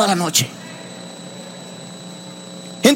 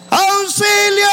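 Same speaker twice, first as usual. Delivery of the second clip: higher calling shout for help; retold cry for help; 6 semitones higher, much slower than usual. Stranded survivor retelling his cry into the jungle, higher call for help. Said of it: "Voy a gritar".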